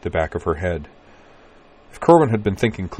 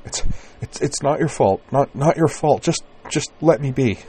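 frightened stammer; 8 semitones higher than usual. Acted. Shivering woman stammers in fear.

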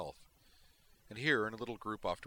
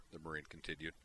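plain reading voice

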